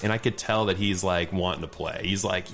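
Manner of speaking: usual speaking voice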